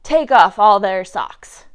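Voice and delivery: loud command; crying out. A narrator's reading. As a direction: shout the order hard. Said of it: "Joe cried out".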